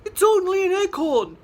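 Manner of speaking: big chick calming the flock. Acted. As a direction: reassure the others, then confide. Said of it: "said the big humongous chicken".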